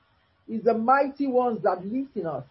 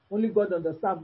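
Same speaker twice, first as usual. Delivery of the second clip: normal talking voice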